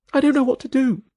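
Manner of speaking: high, small helpless voice; helpless Bellis. A narrator's reading. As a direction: give up, lost.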